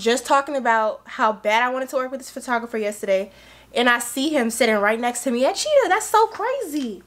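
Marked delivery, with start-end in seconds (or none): 5.9-7.0 much higher, slow amazement; amazed. Real, not acted. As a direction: stretch it out in disbelief. Amazed at the coincidence.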